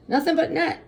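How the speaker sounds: high, loud call; shouting. A narrator's reading. Dad cheering his shot to the neighborhood, shouting. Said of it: "he would shout".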